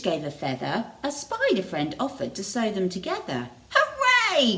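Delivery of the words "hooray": high, loud happy cheer; cheering hooray. A narrator's reading. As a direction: cheer like excited scarecrows. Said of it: "cried the Scarecrows".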